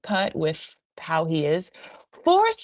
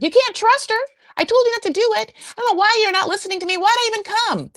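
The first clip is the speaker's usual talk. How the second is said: much higher, louder shrill-free nagging mom; mock mother; 5 dB louder than usual. Imitating Molly complaining in front of Sheena. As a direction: whine it like an overbearing mother.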